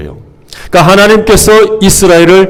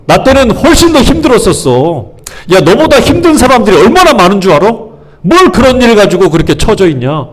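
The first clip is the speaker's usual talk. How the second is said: high-pitched mock reproach; one-up their hardship, scolding as you go; belittling comparison; 4 semitones higher than usual. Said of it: "비교하는 거예요". (비교하며 핀잔주는 높은 말투)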